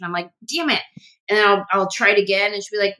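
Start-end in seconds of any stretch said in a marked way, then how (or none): 0.5-0.8 high-pitched retold exasperation; mock frustration. Actress failing an Australian accent for her friend, mock frustration. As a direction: act out a quick, squeaked-up curse.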